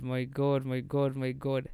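ordinary talk, nothing marked

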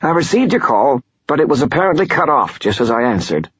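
high, fast nasal lawyer voice; pinched, wheezy and quick. Acted. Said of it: "He had a wheezy, nasal voice".

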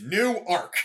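"Newark" as new-ARK, ark given full weight; stress on second syllable. Shown as a demonstration. Insisting on the local name, "Newark" stressed on ark. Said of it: "two syllables with the stress on the second syllable".

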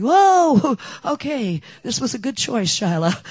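high, loud amazed whoa with a laugh; surprised. Retold or imitated. Narrator amazed at neighbor's joy, high and loud. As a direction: marvel at the turn of events.

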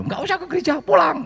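high, shouting Betawi dad calling his son home; angry Betawi father. Retold or imitated. Angry father calls his son away from church, in Betawi. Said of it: "Pokoknya pakai bahasa Betawi".

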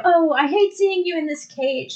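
high mock sympathy; lightly acted tender concern; pitying heroine. Acts Wonder Woman pitying caged gorilla.

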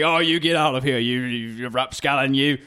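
loud, high British bobby scolding; indignant cod-British telling-off; mock British accent. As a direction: scold like a hammed-up British policeman. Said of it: "our British accents are better than".

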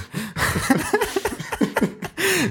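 high helpless laughter. Real, not acted. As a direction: burst out laughing. Cracks up starting the weather.

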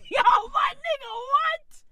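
high, fast shout of disbelief; shocked shriek of outrage; disbelieving shout. Real, not acted. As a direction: yell it like you can't believe it. Outraged at a hit-and-run story.

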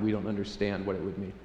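ordinary talk, nothing marked

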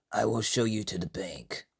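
low, soft character voice; Iridis. Acted. Iridis offering to show Usuke the bank, low and soft.

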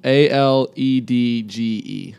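letters spelled out slowly; slow spelling. Real, not acted. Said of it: "What are you spelling?".

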